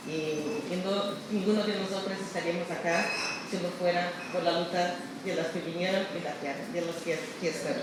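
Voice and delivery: usual speaking voice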